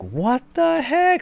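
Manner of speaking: high, slow, puzzled exclamation; startled what the heck. Retold or imitated. Dad hearing a sudden scream: startled, drawn-out question. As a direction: act out being caught off guard by a scream. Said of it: "And I'm like".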